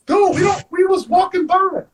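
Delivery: high-pitched, retold protest of innocence; indignant protest. Suspect denying a cop's car break-in charge, indignant.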